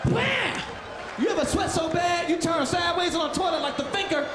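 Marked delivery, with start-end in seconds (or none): 0.1-0.6 high, shouted bam blast; a sudden explosion yelled out. Retold or imitated. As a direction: slam the word out like a blast. Mimics violent toilet blast.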